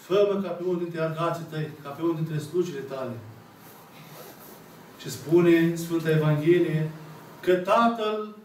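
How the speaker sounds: ordinary talk, nothing marked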